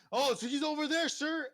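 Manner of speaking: high, loud call to a superior; guard calling out. Acted. Guard calls out to his boss.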